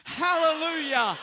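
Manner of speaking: big, loud, joyful hallelujah; one last loud hallelujah. Shown as a demonstration. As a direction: give it all your voice. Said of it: "We can do better".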